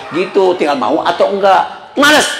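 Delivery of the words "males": high, curt mock snap; imitated cross wife, pitched up; snapped retort. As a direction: snap back at the husband. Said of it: "Dia malah bentak saya".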